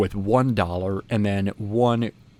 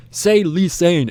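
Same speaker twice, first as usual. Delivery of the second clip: loud, high, slow instruction; imitated prompting; 9 semitones higher, 7 dB louder, slower than usual. Man coaching boys in a Vietnamese phrase, emphatic. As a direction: prompt them slowly and loudly.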